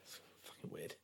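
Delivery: breathy under-the-breath remark; whispered aside. Real, not acted. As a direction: say it almost without voice.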